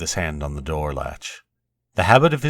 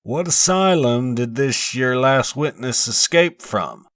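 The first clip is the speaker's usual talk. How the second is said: put-on a higher, slow, challenging voice for the tall old foreman; bearded foreman of sixty; 7 semitones higher, 4 dB louder, slower than usual. A narrator's reading. Foreman of sixty challenging the coroner, slow and high. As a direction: lean on the question like a blunt old countryman. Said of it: "The foreman rose, a tall, bearded man of sixty".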